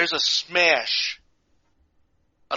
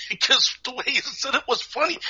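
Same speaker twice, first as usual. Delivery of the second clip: laughing; 10 semitones higher than usual. Real, not acted.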